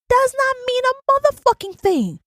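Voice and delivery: ordinary delivery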